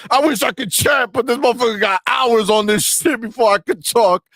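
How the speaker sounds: hammed-up sobbing fanboy fury; mock ponies whining. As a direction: throw a fake crying tantrum. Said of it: "That's what they're saying right now".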